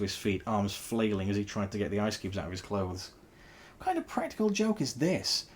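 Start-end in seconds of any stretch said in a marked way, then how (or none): 3.8-5.5 high, indignant outburst; furious yell. A narrator's reading. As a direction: hurl the question, outraged. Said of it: "yelled a furious pig".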